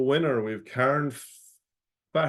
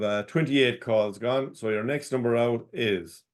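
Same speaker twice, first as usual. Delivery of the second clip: ordinary talk, nothing marked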